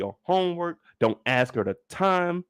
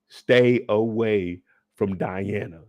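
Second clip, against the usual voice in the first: slow stern warning; 7 semitones lower, much slower than usual. Real, not acted. Warning audience again.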